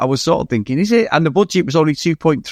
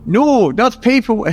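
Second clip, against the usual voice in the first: higher-pitched, shaky grasp of Scottish; failed Scottish accent; 10 semitones higher, much slower than usual. Acted. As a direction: attempt a Scottish accent and miss. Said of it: "Scottish accent's beyond me".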